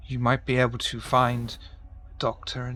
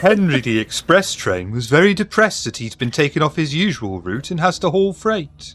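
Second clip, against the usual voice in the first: loud northern English story narration; northern accent, storybook; northern storyteller; 5 semitones higher, 9 dB louder than usual. Acted. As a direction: tell it like a mournful kids' train tale. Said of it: "northern now".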